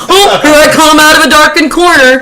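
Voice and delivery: a hammed-up lame slasher entrance; mocking killer. Guest mocking the killer's entrance, in a laughing mock villain voice. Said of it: "He's just".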